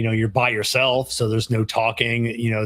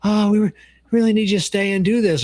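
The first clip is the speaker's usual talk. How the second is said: pleading coworker; 9 semitones higher, 4 dB louder than usual. Retold or imitated. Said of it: "she's like".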